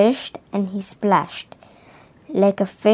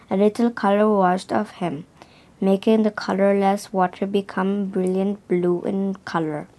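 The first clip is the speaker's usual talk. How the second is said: usual speaking voice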